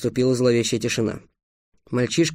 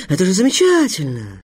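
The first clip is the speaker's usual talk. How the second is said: exclaimed with lifted pitch; delighted; 4 semitones higher than usual. A narrator's reading. Teacher thrilled by the pupils' enmity, delighted exclamation. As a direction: celebrate it with gleeful enthusiasm. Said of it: "восхитился учитель Апф".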